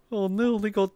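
ordinary delivery

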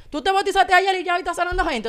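high, loud incredulous challenge; imagined sceptic. Retold or imitated. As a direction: voice a doubter's loud objection. Imagined sceptic accusing her.

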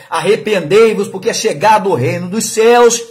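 preaching prophet. Retold or imitated. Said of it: "ele chama raça de víbora".

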